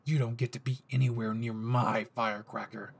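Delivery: low, threatening yandere growl; dangerous possessive boyfriend voice; menacing Bakugo. Acted. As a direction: dare him to repeat it, seething. Bakugo voiced, picking fight.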